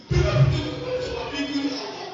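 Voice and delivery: pleading prayer. Retold or imitated. Wife praying at night for her husband, pleading. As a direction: implore God loudly, drawing out the appeal. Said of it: "Dia berdoa".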